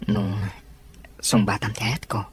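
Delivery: a breathy whisper, barely voiced; whispered reply. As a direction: breathe the words out under your breath. Quietly answering in drama scene.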